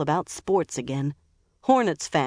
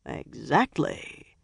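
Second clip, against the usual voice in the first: sly; 3 dB quieter, much slower than usual. A narrator's reading. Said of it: "he slyly said".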